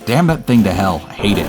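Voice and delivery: ordinary delivery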